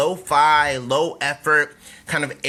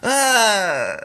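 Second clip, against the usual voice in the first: higher-pitched question into drawn-out groans; mock dismay; 6 semitones higher, much slower than usual. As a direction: act out a sinking, groaning realization. Acting out dismayed realization.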